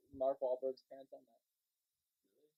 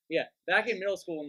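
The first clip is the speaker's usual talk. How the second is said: raised voice, higher and louder; speaking louder; 5 semitones higher, 9 dB louder than usual. Real, not acted. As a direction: speak up so everyone hears. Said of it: "just speak louder".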